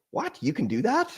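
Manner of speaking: imitating his own amazed reaction; retold surprise. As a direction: squeak it in astonishment, softly. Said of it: "And I was like".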